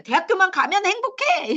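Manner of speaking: high, quick mock parent voice; hammed-up promise from a pushy parent; mimicked parents. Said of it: "이렇게 얘기하는 부모들, 선생님들".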